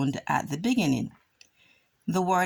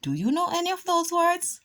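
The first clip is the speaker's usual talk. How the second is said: asking the class; 12 semitones higher, faster than usual. Shown as a demonstration. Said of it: "asking the class".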